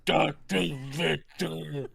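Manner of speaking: slow, halting monster voice. Retold or imitated. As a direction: labour over each word like the creature. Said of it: "he always said things like".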